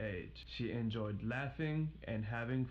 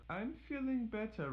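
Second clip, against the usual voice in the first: put-on girl relieved; 12 semitones higher than usual. Little Red answers.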